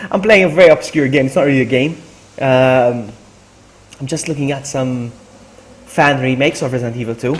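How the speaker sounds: normal talking voice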